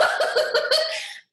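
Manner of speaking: real high-pitched burst of laughter. Real, not acted. Amused by her own story, she breaks into a high laugh.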